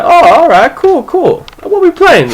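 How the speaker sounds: put-on high, bright eager kid voice. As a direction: squeak it out like a thrilled new kid. Eager new kid picked.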